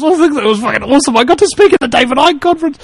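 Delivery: high, rushed burst of delight; excited aside. Real, not acted. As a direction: gush it out fast and high. Delighted aside about speaking there.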